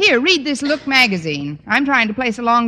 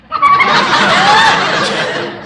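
high burst of caught-out laughter; embarrassed laugh; 6 dB louder than usual. Acted. Connie caught eavesdropping on the line, laughing it off.

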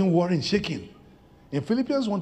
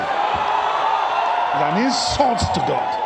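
drawn-out loud "insult"; preaching at full force; slow shouted emphasis; 5 dB louder, much slower than usual. Acted. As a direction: hammer each word slowly. Preacher builds to climax.

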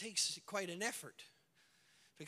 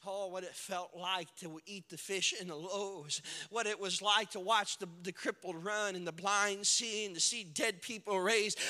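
ordinary delivery

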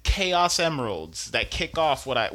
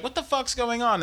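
quoted cry, pitched way up; mock panicked high voice; 9 semitones higher than usual. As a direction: toss it off high and startled. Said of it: "They're like".

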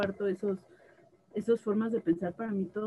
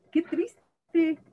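mock sad voice; 7 semitones higher, 5 dB louder than usual. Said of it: "mocking the starers".

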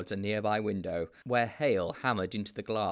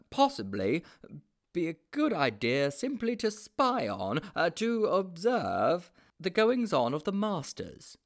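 higher, hesitant schoolboy fumbling; flustered Mildew; 9 semitones higher, 4 dB louder than usual. A narrator's reading. Mildew invents an excuse for the headmaster, flustered and halting. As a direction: grope for a cover story.

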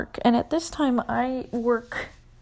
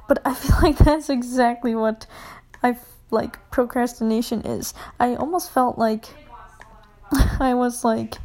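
ordinary talk

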